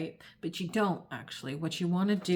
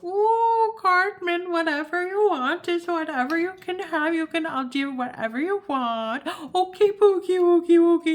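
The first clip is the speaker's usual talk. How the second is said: put-on high, loud gushing baby talk; Cartman's mother; 12 semitones higher, 8 dB louder than usual. Cartman's mother indulging her son, high loud cooing. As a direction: fawn over a spoiled child in a put-on voice. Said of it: "the mother of Cartman is like".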